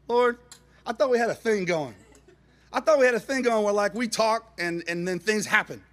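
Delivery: loud, high complaint to the Lord; hammed-up indignant prayer; aggrieved protest. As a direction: protest as if badly let down, easing slightly.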